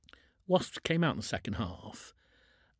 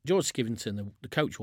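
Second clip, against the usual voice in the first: ordinary delivery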